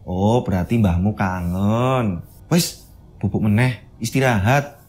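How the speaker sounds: low, slow, soothing Javanese mother; calm the frightened child, slow and low; ibu menenangkan. Acted. Ibu menenangkan Sekar yang panik. (ibu menenangkan dengan suara rendah pelan)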